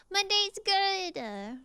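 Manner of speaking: drawn-out loud cheerful answer; excited. Real, not acted. Answering a viewer about Monday, loud and cheerful. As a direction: stretch it out happily.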